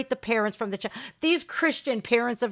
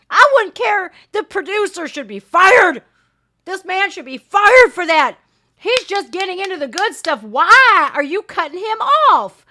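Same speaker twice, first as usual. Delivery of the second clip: angry rant; 7 semitones higher, 6 dB louder than usual. Real, not acted. Ranting at interviewer cutting guest.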